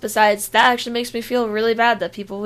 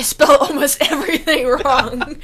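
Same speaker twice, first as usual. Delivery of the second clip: laughing hard, higher and louder; 5 semitones higher, 7 dB louder than usual. Real, not acted. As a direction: burst out laughing mid-sentence. Laughing at own spelling.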